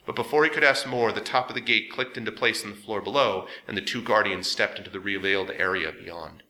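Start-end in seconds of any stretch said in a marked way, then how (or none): none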